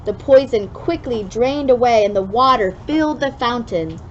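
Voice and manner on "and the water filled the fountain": usual speaking voice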